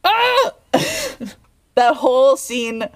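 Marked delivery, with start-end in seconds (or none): 0.0-0.5 sudden high-pitched squeal. Real, not acted. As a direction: squeal with delight. Overwhelmed squeal.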